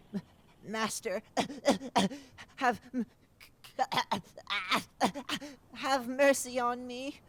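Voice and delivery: high, loud, coughing plea for mercy; coughing monster begging its master; terrified creature. Acted. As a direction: choke out a desperate plea.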